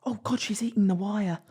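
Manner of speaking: hushed whisper aside. Real, not acted. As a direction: whisper it under your breath. Aside about pet eating wire.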